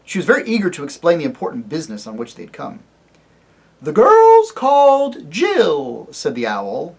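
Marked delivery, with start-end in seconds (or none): 3.8-6.1 high, loud, slow hooting owl. Acted. Owl shouting into the deaf dwarf's ear, loud hoot. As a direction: hoot it as loud as you can. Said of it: "his voice as loud as it could".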